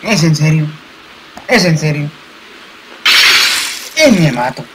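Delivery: loud exasperated outburst; raging gamer. Real, not acted. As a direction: rage at the game. Gamer dies stupidly.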